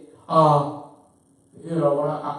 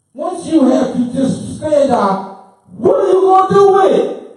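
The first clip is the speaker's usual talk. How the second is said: rising to a real shout, much higher and louder; hollering; 8 semitones higher, 8 dB louder than usual. Real, not acted. Preacher gets carried away, shouting at the crowd. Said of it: "I'm sorry for hollering".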